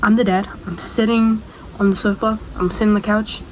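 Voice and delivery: normal talking voice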